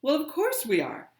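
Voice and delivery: irritated sister retorting; exasperated. Retold or imitated. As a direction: fire it back, annoyed. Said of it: "And I'd say".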